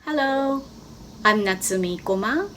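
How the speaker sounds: ordinary talk, nothing marked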